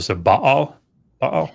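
Baal with stress on the first syllable, "BA-al"; Baal, first syllable stressed, second light. Shown as a demonstration. Scholar demonstrating stress on the first syllable of the name. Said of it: "It's on the, the first syllable".

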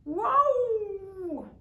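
high, loud drawn-out yowl; a stuck cat yowling. A narrator's reading. Stuck cat yowls down from the tree, high and loud. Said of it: "a yowling, a wailing".

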